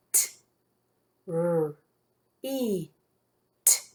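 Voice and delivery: T, R, E, T as isolated sounds; sounding out. Shown as a demonstration. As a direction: say each sound alone with a pause after it. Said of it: "I'll say the sounds".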